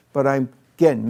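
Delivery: usual speaking voice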